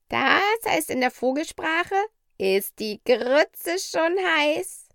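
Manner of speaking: high-pitched parrot, slowing down to translate; talking parrot. Acted.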